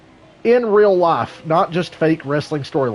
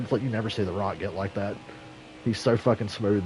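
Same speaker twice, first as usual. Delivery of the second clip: ordinary talk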